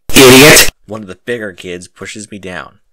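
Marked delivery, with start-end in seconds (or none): 0.1-0.7 sudden loud insult; shout. A narrator's reading. Bigger kid screaming at the narrator. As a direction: spit the word out at him.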